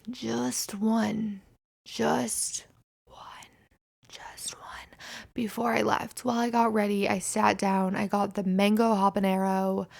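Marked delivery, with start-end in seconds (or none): 1.8-5.0 whispered, fading to near silence; mock ASMR whisper. Shown as a demonstration. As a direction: breathe the words like ASMR. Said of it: "let's do some A S M R".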